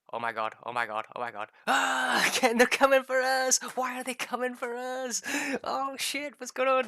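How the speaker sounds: high, loud panicked yelling; screechy-high comic alarm, shouted; mock panic. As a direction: play a hammed-up panic as it builds into shouting. Acts out panic as cows charge.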